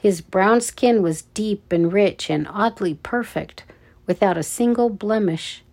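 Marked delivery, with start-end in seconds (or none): none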